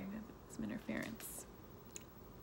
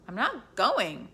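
stubborn refusal; 4 semitones higher, 8 dB louder, much slower than usual. Acted. Child's final stubborn refusal.

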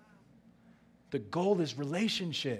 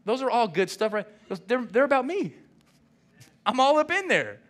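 excited Jesus; 8 semitones higher, 5 dB louder than usual. Retold or imitated. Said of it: "And Jesus goes".

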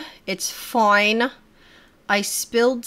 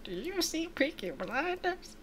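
forced casual; 8 semitones higher, 13 dB quieter than usual. Acted. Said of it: "Try to be as natural as possible".

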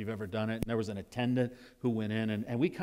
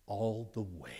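put-on low, soft, secret answer to a child; a hint of a whisper to a little boy; hushed reply; 3 semitones lower, 5 dB quieter than usual. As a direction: lean down and hush it. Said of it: "he gets down like this".

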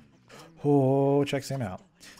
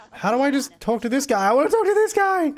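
high, straining wail of frustration; desperate, high-pitched complaint; frantic frustration; 12 semitones higher, 3 dB louder than usual. Real, not acted. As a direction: whine it louder and higher as panic builds. Frustrated, cannot reach game character.

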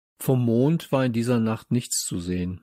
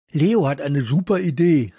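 sudden louder, higher outburst; calling out, mouth full; 4 semitones higher, 6 dB louder than usual. A narrator's reading. Friend blurts out news at the dinner table, calling out. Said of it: "rief Samson plötzlich mit vollem Mund".

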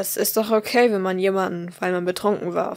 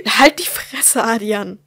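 furious outburst; 6 dB louder than usual. Acted. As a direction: cut him off in fury. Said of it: "unterbrach sie ihn".

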